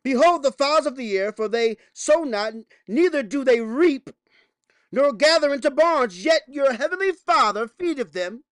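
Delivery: loud, high preacher's scripture reading; preaching scripture. Acted. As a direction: proclaim it from the pulpit. Reads scripture at full preaching force.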